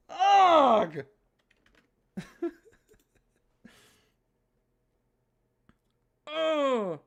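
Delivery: loud frustrated groan; exasperated groans. Real, not acted. Player groaning, fed up with the game. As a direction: groan in disgust.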